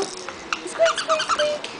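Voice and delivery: high whispered squeak noise; very high unvoiced squeaks; toy squeak.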